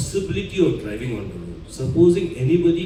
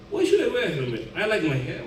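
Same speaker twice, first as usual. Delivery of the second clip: higher-pitched, lightly acted objection; mock protest; 4 semitones higher, 5 dB quieter, faster than usual. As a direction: protest like a reluctant rider, pitched up. Voicing someone objecting to helmets.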